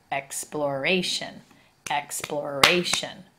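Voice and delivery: explo-RA-tion, slowly, third syllable stressed; stress on third syllable of exploration. Shown as a demonstration. Teacher showing a pronunciation change, stress on RA in exploration. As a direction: draw out "ra" in exploration. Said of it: "We start to accent that third syllable".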